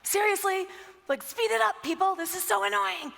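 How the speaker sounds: high, loud, mock exasperated; annoyed outburst. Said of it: "And he was like".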